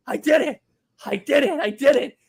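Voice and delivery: high gleeful chant of victory, pitched well up; imitating gloating triumph. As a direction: crow it like you just won. AJ gloating on the roof.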